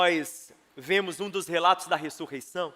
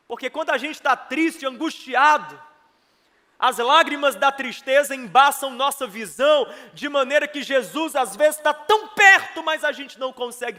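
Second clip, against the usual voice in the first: raised, loud, insistent sermon voice; impassioned, high preaching; full-force preaching; 5 semitones higher, 7 dB louder than usual. Real, not acted. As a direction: preach it urgently to the congregation.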